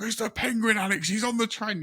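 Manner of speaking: very high, thrilled squeal; delighted squeal. Retold or imitated. Fan calling to friend Alex about the penguin, high squeal. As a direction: squeal with joy at spotting the penguin. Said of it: "I was just so happy to see him".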